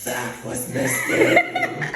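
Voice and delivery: loud, slow, drawn-out acted shock; outraged disbelief. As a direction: echo it back as an appalled mom, stretching it out. Mom's outraged echo of the news.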